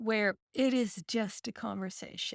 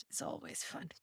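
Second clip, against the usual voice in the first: whispering; 11 dB quieter than usual. Real, not acted. Trailing soft remark.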